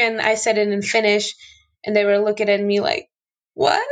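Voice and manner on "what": confused what. Retold or imitated. Players confused by Finnish greeting.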